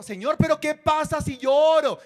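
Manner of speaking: queja fuerte y aguda a Dios; reclamo a gritos. Acted. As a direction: reclama gritando, en parodia. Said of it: "usted grita". (rezo gritado como reclamo)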